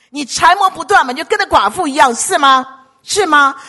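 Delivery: high, loud, pressing urging; urgent exhortation. Real, not acted. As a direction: press the crowd insistently. Urging congregation to plead persistently. (大声迫切地劝勉)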